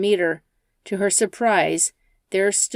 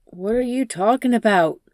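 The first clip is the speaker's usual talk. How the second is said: a touch higher, nervous asking; nervous question; 3 semitones higher, 3 dB louder than usual. Acted. Jason nervously asks the furious passenger. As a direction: sound unsure and uneasy. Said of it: "Jason nervously asks".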